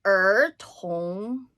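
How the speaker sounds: "Er tong" with a retroflex er, rising; Chinese word. Shown as a demonstration. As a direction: say "Er tong" curling the tongue on er.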